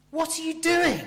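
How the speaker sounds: high, loud cry of disbelief; astonished, shocked. Acted. Preacher voicing Paul to straying believers, aghast. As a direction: burst out in shocked surprise. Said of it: "He's astonished, shocked, surprised".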